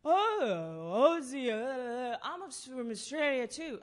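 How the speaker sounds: put-on loud, high, slurry drunk greeting; drunk guy shouting. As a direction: bellow it like a tipsy stranger in a bar. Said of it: "And this drunken, tall guy came up to me and said".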